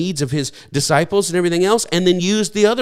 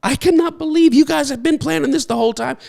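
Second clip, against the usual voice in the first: amazed Paul; 11 semitones higher than usual. Retold or imitated. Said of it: "Paul was like".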